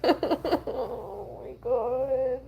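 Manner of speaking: soft, high, crying voice; quietly overcome, voice lifted through tears; tearful. Real, not acted. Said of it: "She's crying".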